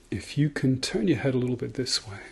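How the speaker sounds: ordinary talk, nothing marked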